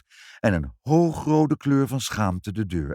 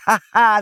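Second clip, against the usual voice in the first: high, loud villain's cackle; gloating laugh; 12 semitones higher, 10 dB louder than usual. Acted. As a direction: cackle in triumph. Villain gloats over stolen umbrella.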